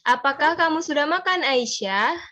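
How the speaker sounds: "makan" a bit high, then falls on "Aisyah"; question intonation. Shown as a demonstration. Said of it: "Agak tinggi habis itu ke rendah". (nada agak tinggi lalu turun ke rendah)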